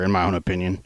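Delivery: ordinary delivery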